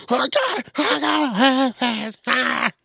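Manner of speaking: high, whiny tantrum wail; tantrum kid. Retold or imitated. Comedian imitating a kid throwing a floor tantrum, high whiny wail. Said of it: "Rolling around on the floor, kicking, screaming".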